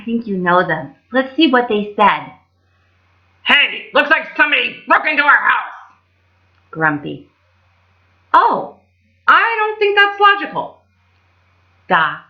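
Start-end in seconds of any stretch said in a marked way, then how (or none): 3.4-5.7 Grumpy. Acted. Grumpy's line, named after. 8.3-10.8 high fussy little doctor; high, prim Doc voice. Acted. Doc's line, named after.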